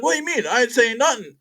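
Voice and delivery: high-pitched defensive protest; mock innocent denial. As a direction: imitate someone caught out and pleading innocence. Acting out Dwayne denying it when confronted.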